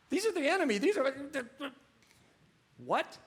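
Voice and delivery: indignant. Retold or imitated. Indignant about Roman soldiers.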